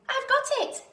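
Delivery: high, loud eureka cry; a tiny snail's sudden idea, high and loud; excited cry. A narrator's reading. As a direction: burst out with the idea. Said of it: "then cried".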